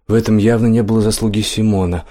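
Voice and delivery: usual speaking voice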